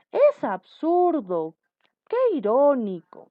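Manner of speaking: high, loud, drawn-out outbursts; demonstrated exclamation. Shown as a demonstration. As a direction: exclaim each phrase slowly and forcefully. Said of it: "dentro está el signo de exclamación". (exclamación fuerte, aguda y alargada)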